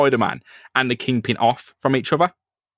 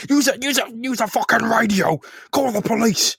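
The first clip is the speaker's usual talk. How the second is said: high-pitched, urgent acted plea; mock frantic; 10 semitones higher than usual. Acting out someone calling for the police, mock frantic. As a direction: cry out for help, hammed up.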